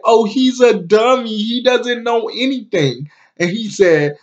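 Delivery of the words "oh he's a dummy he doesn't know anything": imitating a high-pitched, dismissive woman. Vlogger voicing a woman putting a man down, dismissive. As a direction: brush him off in a raised voice.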